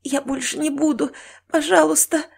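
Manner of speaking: high, frightened pleading; panicked begging. Acted. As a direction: beg for mercy in panic. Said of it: "Наташка впала в панику".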